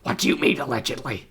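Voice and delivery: lightly put-on impersonation, falling away at the end; mock indignant question in a borrowed voice; Vince McMahon. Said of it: "I did my Vince McMahon voice".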